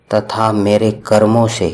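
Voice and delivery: ordinary talk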